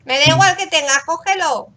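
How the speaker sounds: just take it already; insistent. Real, not acted. Insisting the villager take the gift.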